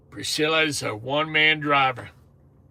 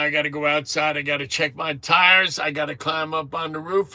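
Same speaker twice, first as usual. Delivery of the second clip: ordinary delivery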